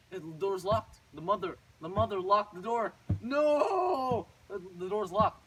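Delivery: loud dismay building to a long, high "no"; hammed-up panicked shouting; alarmed outcry. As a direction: cry out at being trapped. Boy finds himself locked in.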